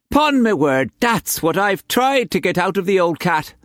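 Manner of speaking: high, fussy, theatrical; a foppish old painter's grievance; Quance voice. A narrator's reading.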